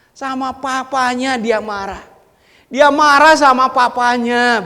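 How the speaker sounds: loud, high-pitched declaration; preaching. Acted. Preacher loudly declaring whom the son is angry with. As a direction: proclaim it loudly.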